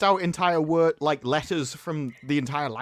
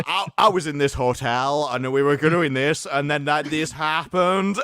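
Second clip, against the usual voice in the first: slow, drawn-out mock Southern US accent; imitated thick American accent; 2 semitones lower, 3 dB louder, slower than usual. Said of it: "you have a very thick accent".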